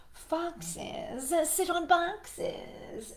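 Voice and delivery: storybook cat lecturing. Acted. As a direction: spell it out for the frog. Cat answers, very slow.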